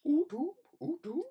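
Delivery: silly high echo of YouTube; playful sing-song. Acted. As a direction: chirp the word YouTube up high. Playful repeat of a word.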